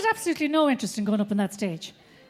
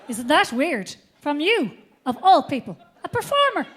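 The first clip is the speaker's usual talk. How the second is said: usual speaking voice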